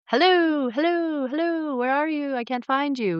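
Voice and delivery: high, sing-song calling hello; mock searching God. Mocking all-knowing God who can't find them, high sing-song call. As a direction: lampoon a clueless god calling.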